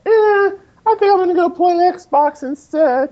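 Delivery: high, drawn-out whiny mimicry; mock slacker quote. Mimicking listeners who get distracted from their limericks, mock slacker. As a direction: put on a loud, high voice for the quote. Acts out distracted contest entrant.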